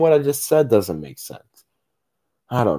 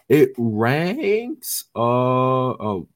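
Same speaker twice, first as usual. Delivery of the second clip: voice cracking; 2 semitones higher, much slower than usual. Real, not acted. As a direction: voice cracks mid-word. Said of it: "sorry for the voice crack".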